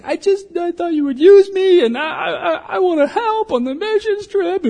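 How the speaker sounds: very high, loud mock cry with laughs; mock sobbing teen. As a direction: blubber like a heartbroken kid, half laughing. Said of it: "I was like".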